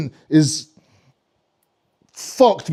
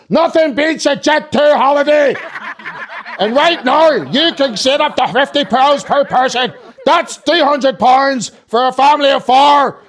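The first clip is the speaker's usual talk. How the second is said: higher, slow upbeat advert read; ad voiceover; 7 semitones higher, slower than usual. Retold or imitated. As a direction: sell it like a cheesy TV ad, laughing. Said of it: "that's a U K advert".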